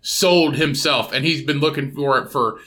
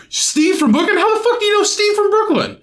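mock amazed celebrity shriek; shocked disbelief. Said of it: "It's like, gasps".

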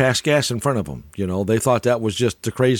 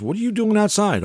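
higher-pitched imitation of her asking; questioning partner; 8 semitones higher than usual. Acts out her questioning him.

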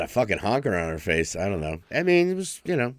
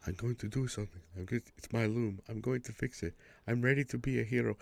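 much softer, timid put-on voice; meek would-be hero; 3 semitones higher, 10 dB quieter than usual. Retelling Victor Timely's doomed heroics in a soft timid voice. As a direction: mumble it like a nervous nobody trying to be brave.

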